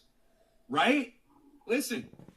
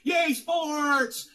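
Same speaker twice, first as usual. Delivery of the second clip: higher, louder sarcastic yay; mock cheer; 7 semitones higher, 8 dB louder than usual. Ranting streamer mocks sports fans with a loud fake cheer. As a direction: ham up a dumb fan cheering.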